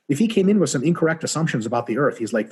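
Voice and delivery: ordinary talk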